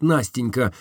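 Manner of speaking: ordinary delivery, nothing marked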